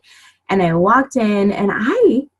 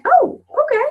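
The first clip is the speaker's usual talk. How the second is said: high, mock-breezy surprise; pitched-up playful no-judgment shrug; surprised acceptance; 10 semitones higher than usual. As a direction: act unfazed by the preacher in jeans. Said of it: "And I'm like".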